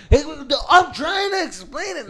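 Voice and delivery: high, loud retold yell; shouting over the club noise; mock yell. Bouncer yelling at him to explain, high mock yell.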